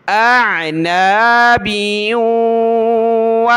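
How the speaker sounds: slow, drawn-out chanted recitation; Quranic recitation. Shown as a demonstration. Teacher models the verse for a student, slow chant.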